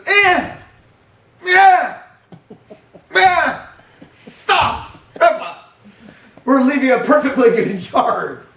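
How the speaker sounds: put-on high, loud yells of a protesting child; shouting grandson. Grandfather imitates grandson yelling to go back to the yard, high and loud. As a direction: bellow it like a toddler dragged away from his yard. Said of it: "And he goes".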